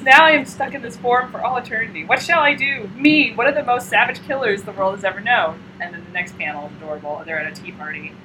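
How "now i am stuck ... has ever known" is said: louder, higher mock lament; melodramatic vampire. Killer vampire trapped in doll form, melodramatic lament. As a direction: declaim it like a wronged villain, hammed-up.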